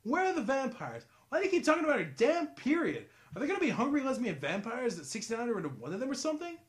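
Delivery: loud, high, incredulous rant; exasperated. Retold or imitated. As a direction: demand answers in disbelief. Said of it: "you ask yourself".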